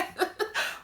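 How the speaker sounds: high breathy giggle; giggly laugh. Real, not acted. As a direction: burst into a delighted giggle. Laughing at seeing Joe.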